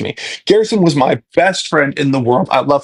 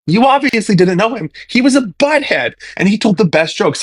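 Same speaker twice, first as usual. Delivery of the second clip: teasing retort; 7 semitones higher than usual. Retold or imitated. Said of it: "And I'm like".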